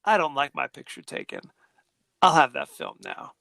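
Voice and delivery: low, controlled demand; stern uncle. Retold or imitated. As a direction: confront them in a low, firm voice. Stern uncle confronts photographer.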